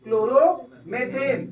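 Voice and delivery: Chloromethane stretched out slowly, syllable by syllable; slow teaching demo. Shown as a demonstration. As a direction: spell chloromethane out slowly for students. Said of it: "तो क्या हो जाएगा?". (Chloromethane धीरे-धीरे खींचकर)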